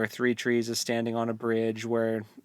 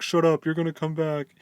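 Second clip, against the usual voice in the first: mock group protest; 6 semitones higher, 6 dB louder than usual. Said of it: "everyone's like".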